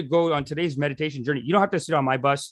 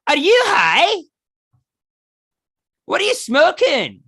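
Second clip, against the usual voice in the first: shrill mocking disbelief, much higher and louder; comic's incredulous jab, pitched way up and loud; scornful comic; 8 dB louder, slower than usual. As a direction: sneer it as the sour comedian. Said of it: "And she goes".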